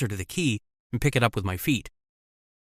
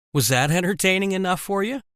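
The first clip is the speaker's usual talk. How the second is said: teasing, higher mocking question; 7 semitones higher than usual. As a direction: taunt her playfully. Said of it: "Jody asked mockingly".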